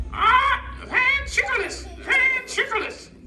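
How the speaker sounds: very high squawky parrot voice; squawk, then repeat "van-trickle-iss" in a high voice; mispronouncing parrot. Acted. Parrot puppet stubbornly repeating the mistake, high squawky garble. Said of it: "I didn't say ventriloquist".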